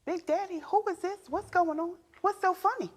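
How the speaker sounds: high, puzzled inner questioning; lightly voiced suspicious thoughts; suspicious wife. Wife wondering who calls her husband Big Daddy, high and puzzled. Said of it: "I'm thinking to myself".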